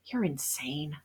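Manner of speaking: whispered, under the breath; a breathy aside; muttered. A narrator's reading. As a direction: mutter it barely aloud. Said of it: "I muttered".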